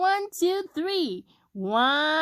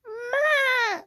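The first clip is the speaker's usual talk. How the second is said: high wobbling bleat; goat bleat; 10 semitones higher than usual. A narrator's reading. Goat greets Blue, high bleat. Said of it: "said a goat".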